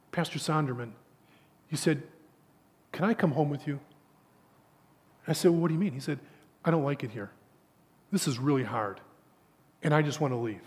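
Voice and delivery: put-on soft, low, a touch of a broken young recruit; distraught plea. Homesick recruit pleading with the pastor, soft and low. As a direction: quietly beg the pastor to take you home.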